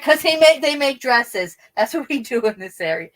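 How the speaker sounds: loud, emphatic imitated mom retort; mock-exasperated Italian mother. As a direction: snap back loudly like an Italian mom. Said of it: "it was so Italian".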